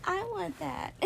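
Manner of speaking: high mock wistful voice; wistful envy. Retelling envying a happy friend, high wistful. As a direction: sigh it out with envy. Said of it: "and I'm like".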